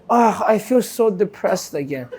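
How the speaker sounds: mock sad moan; mopey, slow and soft. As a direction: drag it out like a mopey sigh. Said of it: "Instead of going".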